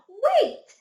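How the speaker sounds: high, loud desperate call; crying out. A narrator's reading. Duck calls out loud and high to the departing ducks. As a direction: shout after the ducks flying off. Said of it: "Duck cried".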